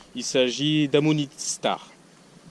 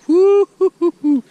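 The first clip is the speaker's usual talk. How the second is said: high loud joyful whoops; excited whooping; 12 dB louder than usual. Real, not acted. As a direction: hoot with delight, echoing. Joie après bonnes attaques. (cris de joie aigus)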